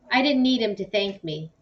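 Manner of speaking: ordinary delivery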